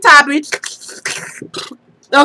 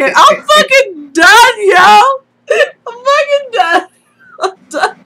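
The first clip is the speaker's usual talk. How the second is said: high, worn out from laughing; helpless tearful laughter; 7 semitones higher than usual. Real, not acted. Friend declaring herself done, laughing to tears. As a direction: give up, overcome with laughter. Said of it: "I'm crying".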